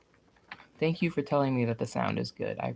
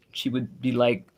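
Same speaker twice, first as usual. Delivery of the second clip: ordinary delivery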